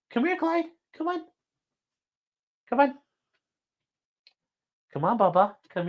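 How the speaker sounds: high coaxing call to a pet; high pet-talk coaxing the dog to come. Real, not acted. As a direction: coax the dog over, high and sweet.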